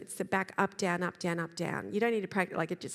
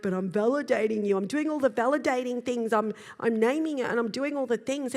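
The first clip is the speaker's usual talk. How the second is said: defensive protest; 3 semitones higher, 5 dB louder than usual. Retold or imitated. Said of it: "it's like".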